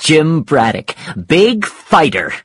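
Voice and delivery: scornful challenge to a fighter; taunting. Acted. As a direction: draw it out as a taunt. Mike taunts Jim during quarrel.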